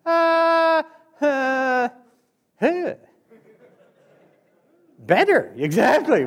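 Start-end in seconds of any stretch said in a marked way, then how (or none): 0.0-2.9 high, floaty groans of relief; morphine sighs. Retold or imitated. Patient on morphine, imitating dreamy high moans. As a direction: drift off as the morphine kicks in. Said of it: "I remember laying there doing this".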